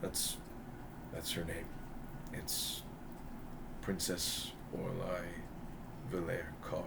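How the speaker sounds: low, soft, measured reproach; stern princess correction. Acted. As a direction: chide him quietly. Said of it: "She goes".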